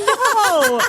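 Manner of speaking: very high, drawn-out comic no; mock protest. Mock refusal to student's logic.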